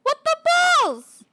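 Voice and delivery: high, loud disbelief; real high-pitched surprise; startled outburst. Real, not acted. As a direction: blurt it out in shock. Startled in game.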